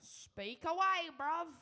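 slow, loud, high mock Cockney; hammed-up London geezer call; mock Cockney catchphrase. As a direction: show catchphrase in put-on Cockney. Intro catchphrase in mock accent.

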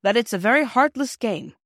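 put-on stern doctor scolding children; rather stern. A narrator's reading. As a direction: reprimand them firmly. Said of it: "he said it rather sternly".

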